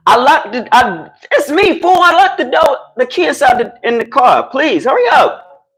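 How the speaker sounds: high, stretched-out plea through a door; pleading call. Real, not acted. Locked out, begging those inside to open up, high pleading call. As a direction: call out urgently to be let in.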